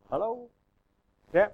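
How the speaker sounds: usual speaking voice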